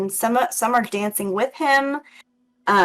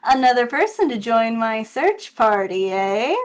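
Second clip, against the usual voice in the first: goblin jester; 4 dB louder than usual. Acted. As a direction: greet a newcomer in a lilting goblin voice. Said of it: "He looks at you and he says".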